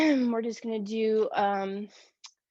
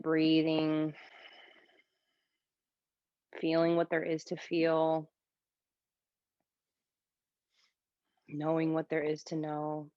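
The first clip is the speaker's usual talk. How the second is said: calm yoga teacher voice with long pauses; guided meditation; 5 semitones lower than usual. Shown as a demonstration. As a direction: ease them into stillness, leaving space between phrases. Leads breathing meditation in yoga class.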